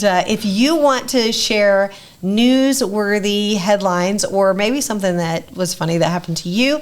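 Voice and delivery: ordinary talk, nothing marked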